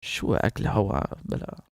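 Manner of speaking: normal talking voice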